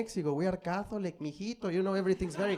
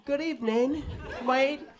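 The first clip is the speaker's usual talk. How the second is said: higher-pitched British greeting; mock British accent; 7 semitones higher than usual. Comedian greets British audience member, in mock British accent. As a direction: put on a British voice. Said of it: "there's some British there in the back".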